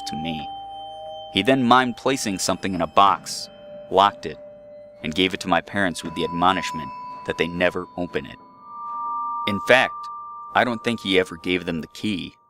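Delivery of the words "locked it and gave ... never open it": usual speaking voice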